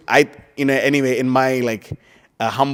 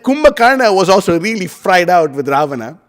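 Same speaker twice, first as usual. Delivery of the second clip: ordinary talk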